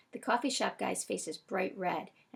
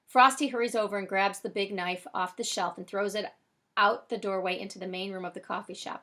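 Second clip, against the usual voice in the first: plain reading voice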